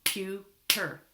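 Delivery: "Computer" slow, split into three beats; clapped out syllables. Shown as a demonstration. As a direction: spell out each syllable of "Computer" slowly. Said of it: "Let's clap it out".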